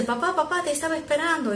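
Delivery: put-on high, eager little boy calling his dad; a small son greeting his tired father, high and eager; child's voice. Boy waiting up for his dad, high eager child voice.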